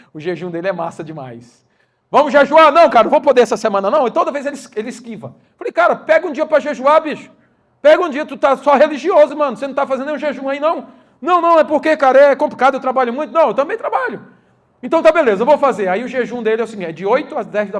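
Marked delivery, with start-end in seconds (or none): 2.1-4.1 acted dialogue. 5.7-10.9 higher, louder scolding push to fast; scolding brother. Retold or imitated. Pastor pressing his brother to fast, loud and higher. 11.2-15.9 higher, louder whiny excuses; brother's excuses. Retold or imitated. Brother dodging the fast, higher whiny excuses.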